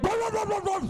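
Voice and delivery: high loud barks like a little dog; dog barking. Pretend. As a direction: yap it out like a dog. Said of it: "she's like".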